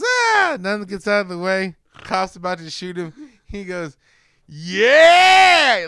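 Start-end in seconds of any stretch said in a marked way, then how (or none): 0.0-0.6 short high mock scream; loud imitated shriek. 4.5-5.9 long, high, loud yeah; hammed-up triumphant shout; mock villain yell. Acting out villain's final cry.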